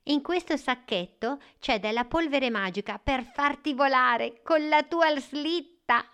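lightly voiced eager boy; a hint of an excited child. The boy Nicola speaks. (voce da bambino)

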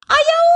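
high, loud cartoon yelp; yelp of pain. A narrator's reading. Rabbit punches the wolf, high loud yelp. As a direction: squeal out as the punch hits.

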